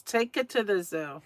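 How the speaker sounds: usual speaking voice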